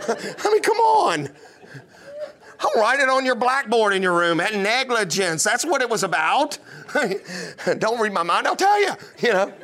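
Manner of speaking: normal talking voice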